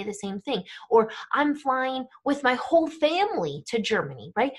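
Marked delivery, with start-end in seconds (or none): 1.3-4.3 "whole family" stretched and stressed. Shown as a demonstration. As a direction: lean hard on "whole family". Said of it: "We use our voice in English to say that".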